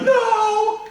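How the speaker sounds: very high, loud squeal of refusal. Retold or imitated. Everyone refusing God's command, in a shrill mock shriek.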